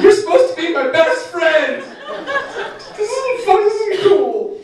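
high-pitched hurt complaint to his dog; high whiny plea. Retold or imitated. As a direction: whine it like a betrayed owner. Pleading with dog in retold quote.

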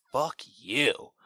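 hammed-up sarcastic teen swearing; reenacted teen outburst. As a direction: imitate the kid's overdone delivery. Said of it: "I gotta reenact, uh, the one kid's, uh, way he says one word".

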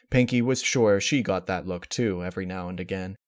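ordinary delivery, nothing marked

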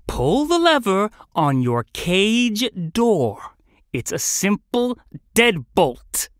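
very slow, strained patience; forced calm, drawn-out instructions for a slow listener; trying to sound calm. Acted. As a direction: spell it out slowly, holding the temper in. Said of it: "He tried to sound calm".

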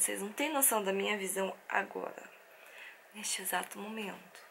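hushed aside to the viewers; barely voiced, breathy confession; whisper. Real, not acted. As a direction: whisper it like a guilty secret. Showing a messy room on camera.

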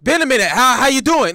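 loud, high-pitched acted greeting; playful confrontational hello; mock casual challenge. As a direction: greet them loudly, squaring up. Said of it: "he looking at you like".

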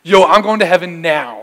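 loud, elated outburst; mock thrilled shout of joy; excited. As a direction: blurt it out like you're already halfway to heaven. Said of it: "I'm like".

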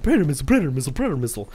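mock giddy repeated exclamations; retold excitement. Gamer retelling his reaction to a missile, mock excitement. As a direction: chant it like an over-excited gamer. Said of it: "I was, like, so excited".

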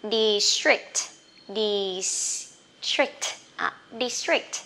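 district said slowly, a hiss before trick; model district. Shown as a demonstration. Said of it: "xì một cái rồi mới trick".

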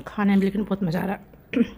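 chewing while talking; eating mid-sentence; mouth full. Real, not acted. Seen 1: man bit food at -2s, jaw working while talking.

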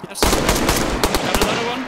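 excited slow callout. Real, not acted. Another kill in the game.

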